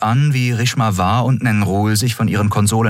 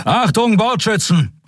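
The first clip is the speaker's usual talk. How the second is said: calling out. Acted. Admiral calls the gunners to attention, shouting. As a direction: hail the gunners sharply. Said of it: "rief er".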